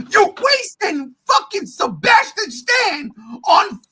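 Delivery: very high, loud tirade; furious ranting. Real, not acted. Fan demanding Luke Skywalker from Disney, furious shouting. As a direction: yell at the top of your range, outraged.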